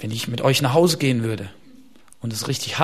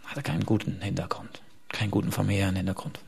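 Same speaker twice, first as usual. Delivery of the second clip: hushed aside; 6 semitones lower, 7 dB quieter than usual. Real, not acted. Hushed aside about Abraham's past.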